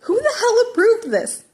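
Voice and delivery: high, loud, incredulous outburst; outraged. Acted. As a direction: explode in disbelief. Teacher outraged.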